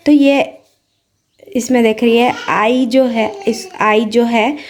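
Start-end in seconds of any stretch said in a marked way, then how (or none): none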